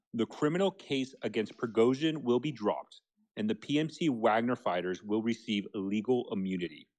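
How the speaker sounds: slow, measured, low-pitched read of a quote. Real, not acted. Rereading a Telegram report for the host on request, slowly. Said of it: "read that again also slowly, please".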